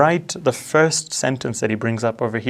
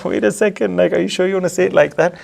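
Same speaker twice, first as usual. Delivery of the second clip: higher-pitched acted disbelief; mock incredulous, pitched up; incredulous questioning; 6 semitones higher than usual. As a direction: voice a doubtful listener checking they heard right. Voicing incredulous listeners questioning the claim.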